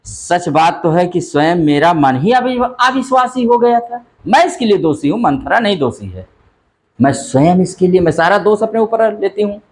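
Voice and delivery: lightly acted, high-pitched self-blame; Kaikeyi. Teacher voicing Kaikeyi taking the blame herself, lightly acted confession. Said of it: "कह रहे हैं".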